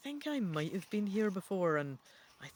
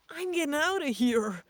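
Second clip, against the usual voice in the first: a high, loud frightened squeak; 8 semitones higher, 6 dB louder than usual. A narrator's reading. Rodney flees the headless ghost, a high panicked squeak. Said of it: "he squeaks".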